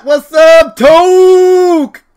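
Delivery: loud, high call with a drawn-out name; excited greeting. Real, not acted. Streamer calling to his YouTube hero, excited. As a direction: holler hello, stretching the name. Calls out to visitor.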